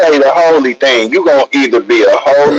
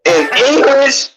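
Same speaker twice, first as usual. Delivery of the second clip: higher, indignant, disbelieving retelling; incredulous retelling; 11 semitones higher than usual. Retold or imitated. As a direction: replay your shock, high and worked up. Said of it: "tongue that I can understand".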